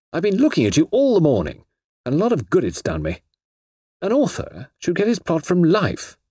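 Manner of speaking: exasperated author rising to decision; scolding typewriter. Acted. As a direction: scold the machine, then resolve.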